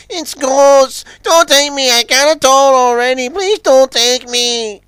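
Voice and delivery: very high, panicked wail; Izzy crying out. A narrator's reading. Izzy begs the ghosts to spare him, wailing. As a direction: plead loudly for your life, drawing out the end. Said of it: "cried Izzy".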